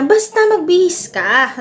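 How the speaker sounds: mock-stern insistence to get Mama dressed; mock annoyed. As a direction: pretend you're fed up and insist. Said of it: "kunwari, nagagalit pa nga ako".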